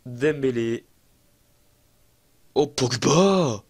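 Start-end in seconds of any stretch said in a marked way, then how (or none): 2.5-3.6 drawn-out delighted shout of the name; excited cry. Real, not acted. Gamer cheering a goal for his team, drawn-out shout. Goal celebration in football game.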